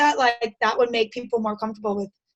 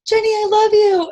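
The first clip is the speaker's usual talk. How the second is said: high, louder imitated call across a store; retold yell; 9 semitones higher, 6 dB louder than usual. Sister-in-law yells across the supermarket, imitated high call. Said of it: "she yells it across the supermarket".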